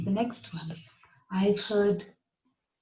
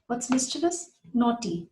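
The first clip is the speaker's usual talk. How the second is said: normal talking voice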